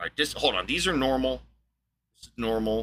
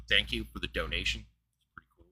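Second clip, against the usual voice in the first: whispered aside; 5 semitones lower, 8 dB quieter than usual. Real, not acted. Aside thanking donor.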